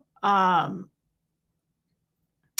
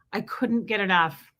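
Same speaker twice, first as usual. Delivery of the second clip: ordinary talk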